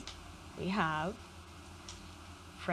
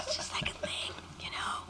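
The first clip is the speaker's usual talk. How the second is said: whispering; 6 dB quieter than usual. Acted. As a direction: whisper with a little laugh. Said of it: "I know how to whisper really well".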